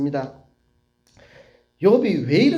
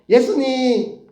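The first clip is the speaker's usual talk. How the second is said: put-on high, eager child's reply; acted little child's answer, pitched up; kindergartner answering; 8 semitones higher, 4 dB louder, much slower than usual. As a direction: chirp it like a Sunday school pupil. Children answering the teacher in chorus.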